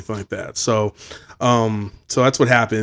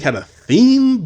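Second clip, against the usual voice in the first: normal talking voice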